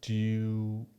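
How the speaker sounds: ordinary talk, nothing marked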